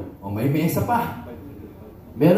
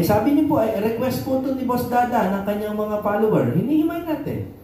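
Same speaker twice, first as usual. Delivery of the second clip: raised voice; 7 semitones higher than usual. Real, not acted. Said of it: "tumataas ang boses ko".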